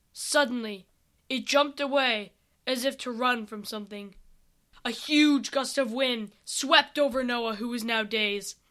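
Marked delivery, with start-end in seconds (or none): none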